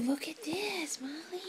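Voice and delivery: soft whisper to the dog. Real, not acted.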